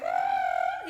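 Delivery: very high fairy trill; a tiny, airy high note; hummingbird Glenda. Retold or imitated. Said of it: "her voice is like a fucking hummingbird".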